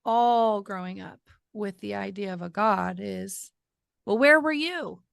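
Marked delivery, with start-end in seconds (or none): 4.0-5.0 high, loud accusing question; hurt challenge. Retold or imitated. Growing up doubting God, challenging and hurt. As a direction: throw it out as a raised-voice demand. Old grievance voiced to God.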